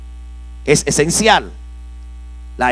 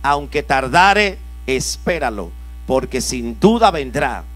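scripture reading; 4 semitones lower, 3 dB quieter than usual. Acted. Preacher reads scripture aloud.

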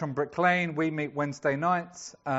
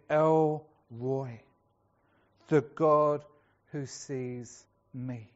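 tender emphasis; 4 semitones lower, much slower than usual. Real, not acted. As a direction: let it land gently and slowly. Preacher slows on El Roi.

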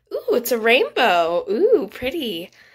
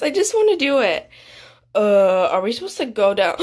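ordinary delivery

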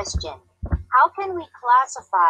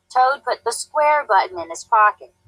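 plain reading voice

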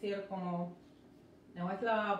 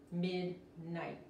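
ordinary talk

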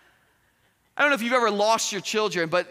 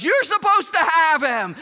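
high, loud mock accusation; acted marital squabble; arguing; 7 semitones higher, 6 dB louder than usual. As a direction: throw the blame back, loudly. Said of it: "they're arguing back and forth".

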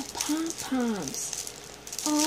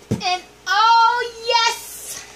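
joyful squeal; 11 semitones higher, 6 dB louder, much slower than usual. Real, not acted. Thrilled by a gift.

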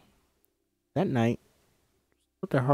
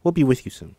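usual speaking voice

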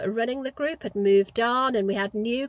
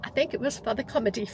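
ordinary talk, nothing marked

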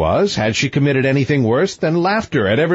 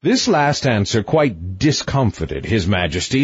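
plain reading voice

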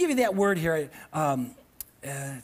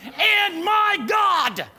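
preaching shout; 8 semitones higher, 5 dB louder, much slower than usual. Real, not acted.

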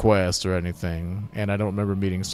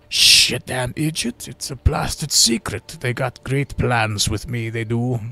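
slow, raised, throat-taxing dwarf voice; strained dwarf mayor; 4 semitones higher, 4 dB louder than usual. Acted. Dwarf mayor scolds human over blasted secret, strained character voice. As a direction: scold slowly in a punishing character voice. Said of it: "Sorry, this voice is killing me".

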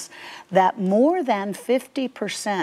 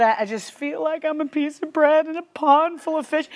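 whiny; 10 semitones higher than usual. Retold or imitated. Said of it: "you talk about whiny".

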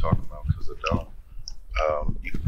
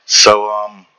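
ordinary talk, nothing marked

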